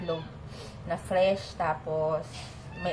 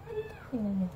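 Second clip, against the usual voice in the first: usual speaking voice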